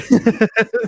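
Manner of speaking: sudden high, loud laugh; real burst of laughter. Real, not acted. Laughs at friend's joke.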